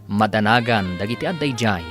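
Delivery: normal talking voice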